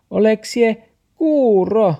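high, loud, slow yell; scolding taxi. A narrator's reading. Taxi scolds Max for not hearing, high yell. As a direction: yell it slowly at someone not listening. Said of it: "taksi jylkytti".

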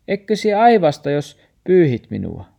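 dog asking, high and loud; doubtful dog question. Acted. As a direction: ask anxiously if she'll sneeze.

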